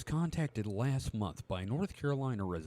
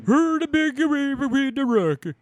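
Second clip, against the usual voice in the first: high, slow, sing-song; 11 dB louder, slower than usual. Pretend. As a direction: draw it out high like a cartoon. Joining the joke in a voice.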